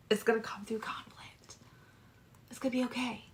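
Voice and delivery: breathy whisper, voice dropped right out. Shown as a demonstration. Tarot reader whispering a warning to Sagittarius. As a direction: let it slip out as a secret whisper.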